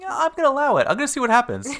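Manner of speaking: mock permissive parent. Parent hears child screaming for help, mock permissive. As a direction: play an indulgent parent in a high, loud voice.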